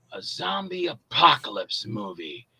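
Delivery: low, slow, hushed announcer-like delivery; mock-ominous deep voice; co-star's movie line. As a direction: intone it ominously, drawn out and soft. Acts co-star's reply in film scene.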